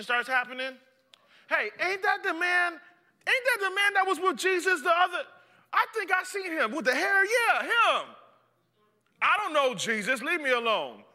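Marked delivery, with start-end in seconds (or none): 1.5-8.1 much higher, louder nosy voice; accusing bystander. Acted. 9.2-11.0 agitated denial. Acted. Peter denies, agitated.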